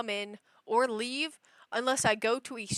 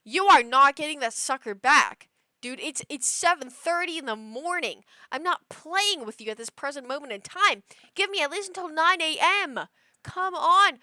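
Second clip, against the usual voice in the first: worked-up rant, rising higher; exasperated scolding; 4 semitones higher, 5 dB louder than usual. Retold or imitated. Owner refusing to play with cat at seven thirty, exasperated. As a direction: plead and scold at once, getting higher.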